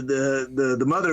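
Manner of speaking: ordinary talk, nothing marked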